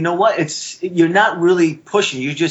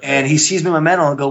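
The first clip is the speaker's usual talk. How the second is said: usual speaking voice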